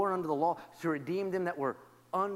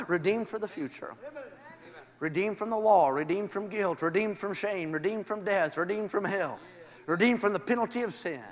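normal talking voice

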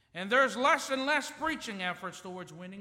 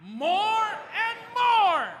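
much higher, louder, very slow and stretched; full enthusiasm; 11 semitones higher, 11 dB louder, much slower than usual. Shown as a demonstration. Pastor calling for one more time, big enthusiastic shout. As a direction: shout it out with joy. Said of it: "One more time".

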